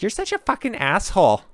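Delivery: high put-on voice of a girl snapping back; imitating angry girl. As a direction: squeak her insult in a mocking high pitch.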